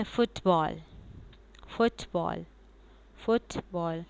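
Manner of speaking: फुटबॉल said slowly in two separate parts; फुटबॉल carefully spaced for learners to repeat; repeat-after-me model. Shown as a demonstration. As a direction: model फुटबॉल, releasing the ट before बॉल. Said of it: "अब आप बोलें".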